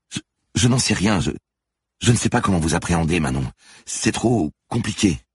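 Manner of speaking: high, stammering distress; falter, close to giving up; overwhelmed. A narrator's reading. Manon overwhelmed, cannot cope.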